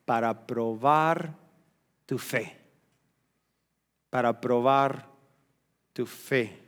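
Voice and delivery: low, slow and deliberate; slow, weighty answer. Real, not acted. Preacher explaining why God keeps silence, slow and weighty. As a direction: space out the phrase to sink in.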